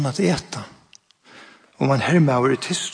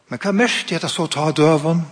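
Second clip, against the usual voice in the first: ordinary talk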